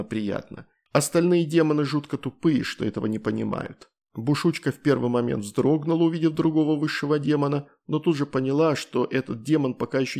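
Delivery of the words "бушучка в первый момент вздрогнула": ordinary delivery, nothing marked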